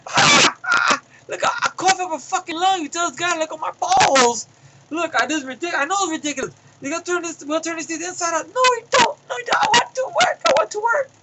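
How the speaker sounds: high, loud squealing protest; panicked protest. Retold or imitated. Patient squealing at the doctor during the hernia exam. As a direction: beg your way out, rising in panic.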